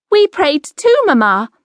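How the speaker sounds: put-on a boy answering eagerly; young boy. A narrator's reading. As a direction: son John to his mother, high and eager. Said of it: "John said".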